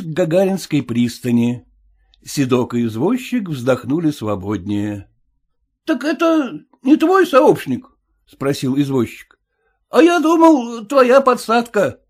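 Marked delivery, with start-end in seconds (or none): 5.8-12.0 much higher, louder wary coachman; high suspicious cabbie; cab driver voice. Acted. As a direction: play the sly cabbie, pitched well up.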